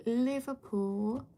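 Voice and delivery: slow, drawn-out try at a Liverpool accent; tentative Liverpool accent, stretched out; attempted Liverpool accent. Shown as a demonstration. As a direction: test out a Liverpool accent on the word. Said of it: "The Liverpool accent? What even is that?".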